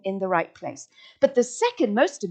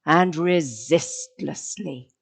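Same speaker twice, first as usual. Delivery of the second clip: hushed, drawn-out words with long pauses; very slow triad; much slower than usual. Shown as a demonstration. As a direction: stretch "mysteriously", "slowly", "resistlessly" far apart. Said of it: "your slowly has to be slow".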